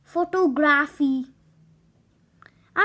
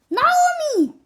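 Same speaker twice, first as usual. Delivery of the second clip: sharp, higher warning call of the name; scolding; 7 semitones higher than usual. Acted. The teacher cuts off Naomi's rude apology, scolding. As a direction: stop her mid-insult.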